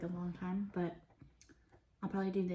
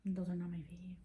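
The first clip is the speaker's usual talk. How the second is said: whispering; 4 dB quieter than usual. Real, not acted. Whispered aside.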